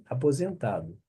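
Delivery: ordinary delivery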